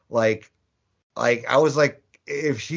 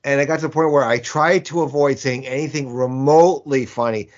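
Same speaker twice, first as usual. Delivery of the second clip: normal talking voice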